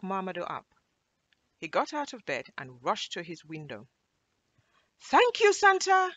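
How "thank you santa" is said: put-on high, loud boy's call; excited, high-pitched holler; shouted thanks. A narrator's reading. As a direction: shout it up to the departing sleigh. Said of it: "he shouted".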